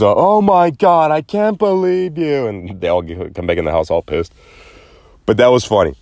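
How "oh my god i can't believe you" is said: put-on high exasperated girls' groan; pranked kids, indignant and whiny; outraged daughters. Daughters realising the prank, high and exasperated. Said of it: "And they just".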